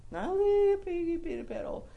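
put-on very high, teeny-tiny voice; tiny mock voice. Shown as a demonstration. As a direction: make it sound itty-bitty. Mocking smallness against a huge ledge.

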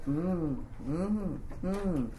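low, soft "mm"s, spaced out; feigned attentive hums. Shown as a demonstration. Principal pretending to listen to a parent, low vague hums. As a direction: hum as if paying attention. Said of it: "sound like I'm paying".

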